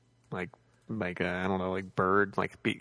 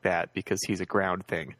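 ordinary delivery

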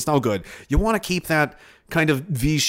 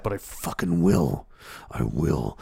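ordinary talk, nothing marked

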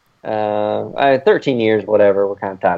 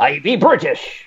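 higher, hammed-up pirate talk; 5 semitones higher than usual. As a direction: declare it like a silly pirate. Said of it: "The pirate?".

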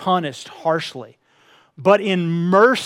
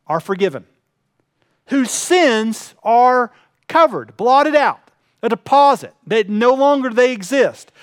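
slow, raised, louder pulpit cadence; fervent sermon climax; fervent preaching; 4 semitones higher, 3 dB louder than usual. Acted. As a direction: preach each blessing out, drawing it long. Preacher builds to emphatic climax.

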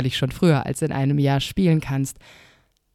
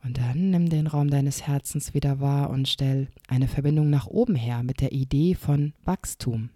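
guided meditation. Shown as a demonstration. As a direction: lead a gentle inner journey. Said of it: "eine kleine Meditationsübung mit dir machen".

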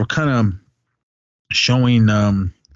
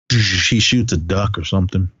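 ordinary delivery

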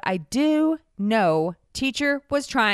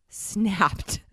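usual speaking voice

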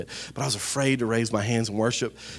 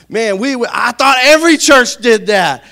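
high, loud, slow exclamation; loud disbelief; 11 semitones higher, 13 dB louder, slower than usual. Real, not acted. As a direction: cry it out, astonished, stretching the words. Mock disbelief at own past.

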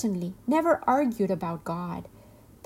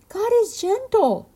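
very high, loud chirp; high-pitched storybook robin voice; 13 semitones higher, 7 dB louder than usual. A narrator's reading. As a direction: chirp it like a little bird. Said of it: "chirped the robin".

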